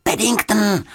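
high urgent cry of the name; high-pitched girl's shout; shout over shoulder. A narrator's reading. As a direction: call out a high alarm. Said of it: "křikla Juditka přes rameno".

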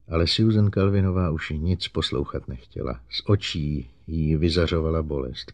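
ordinary delivery, nothing marked

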